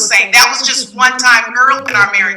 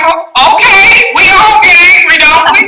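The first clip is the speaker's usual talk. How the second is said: high, louder, breezy and laughing; flippant laughing reply; 8 semitones higher, 5 dB louder than usual. Retold or imitated. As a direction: brush off his anger with a chuckle. Retelling her flippant reply.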